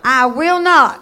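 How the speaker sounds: loud, ringing declaration for the church to echo; emphatic call to repeat. Shown as a demonstration. Preacher leading the church in a loud declaration. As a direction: lead the congregation, each word hammered. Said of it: "say this with me".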